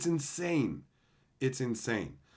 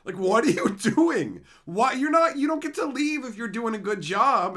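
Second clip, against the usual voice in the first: high, loud mock outrage; pissed off; 10 semitones higher, 7 dB louder than usual. Bosses angry that worker leaves early, mock pissed-off. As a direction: imitate an exasperated, indignant boss. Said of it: "getting pissed at me".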